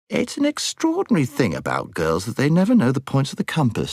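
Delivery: high-pitched, exasperated schoolboy; schoolboy Eustace, exasperated. Acted. Eustace complains about girls.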